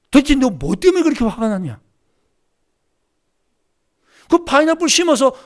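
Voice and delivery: loud, stern scolding from above; a voice of God chewing him out; stern rebuke. Retold or imitated. God scolding the missionary at the seminar, loud stern rebuke.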